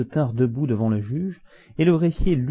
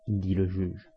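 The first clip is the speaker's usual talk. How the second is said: usual speaking voice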